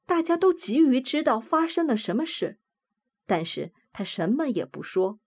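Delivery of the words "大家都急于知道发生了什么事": plain reading voice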